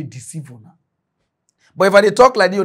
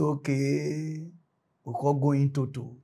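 resigned; 4 semitones lower, 9 dB quieter than usual. Retold or imitated. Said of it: "we come say".

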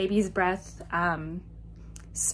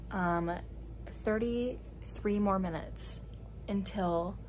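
quiet; 2 semitones lower, 9 dB quieter, slower than usual. Real, not acted. Late at night, quiet.

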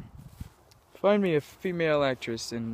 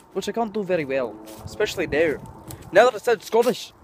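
higher-pitched bad Russian accent sliding into Scottish; botched Russian female accent; 7 semitones higher, 6 dB louder than usual. Shown as a demonstration. Vlogger showing he can't do Russian, bad accent turning Scottish. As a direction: attempt a Russian woman's voice and get louder as it fails. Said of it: "like, a Russian female voice".

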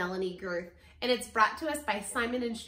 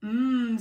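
long, loud "mmm"; storybook farmer humming with relish; farmer's appreciative hum; 8 dB louder than usual. A narrator's reading. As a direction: savour it with a big hum. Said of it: "says the farmer".

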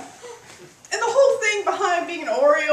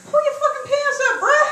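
exasperated scold; 10 semitones higher, 5 dB louder than usual. Retold or imitated. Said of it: "I'm like".

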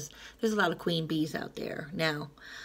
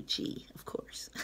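whispered aside; 11 dB quieter, slower than usual. Real, not acted. Spelling out the joke name.

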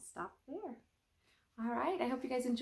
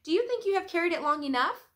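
higher, louder, quick question; a bright teasing check-in from Stillwater; Stillwater asking; 9 semitones higher, 8 dB louder than usual. Acted. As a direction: panda Stillwater gently probing his friend. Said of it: "asked Stillwater".

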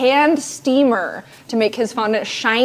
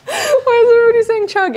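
laughing; 13 semitones higher, 5 dB louder than usual. Real, not acted. Laughing protest.

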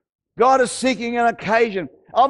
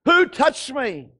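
raised-pitch demanding cry; 3 semitones higher than usual. Acted. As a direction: call it out as Jesus, slowing on the repeat. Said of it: "Jesus cried out".

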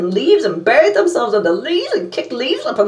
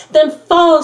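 excited puppy; 5 semitones higher, 6 dB louder than usual. A narrator's reading. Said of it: "said the second puppy".

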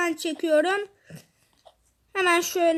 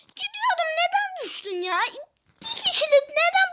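dismayed yelp; 11 semitones higher than usual. Real, not acted. Teammate fell, dismay.

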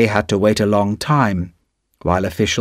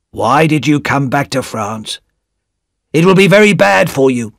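Defarge, lightly voiced; 2 semitones higher than usual. A narrator's reading. Defarge warning Darnay, lightly voiced and guarded. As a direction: warn him in a lightly put-on voice.

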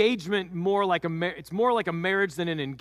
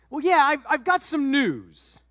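put-on high, halting, embarrassed young wife; nervous Mary; 7 semitones higher, 5 dB louder than usual. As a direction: hedge it, high and slow, scared to tell. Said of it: "A little flushed".

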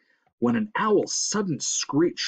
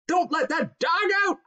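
high, screechy order; high-pitched panicked housewife; cried out; 14 semitones higher than usual. A narrator's reading. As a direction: cry it out in alarm. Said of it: "cried Missus Blevins".

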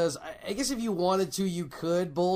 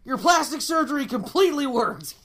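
mock excited; 7 semitones higher, 5 dB louder than usual. Repeats mock excuse louder.

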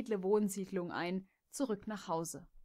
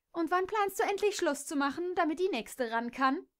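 spiteful question; 9 semitones higher, 4 dB louder than usual. Acted. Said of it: "fragte Shirley missgünstig".

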